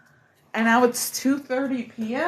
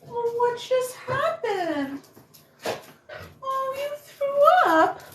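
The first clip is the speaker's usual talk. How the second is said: high, cooing voice for a dog; 12 semitones higher, slower than usual. Real, not acted. To dog after vomiting.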